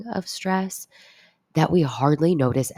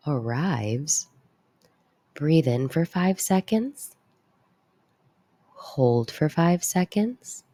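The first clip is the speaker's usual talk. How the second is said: calm grounding exercise. Acted.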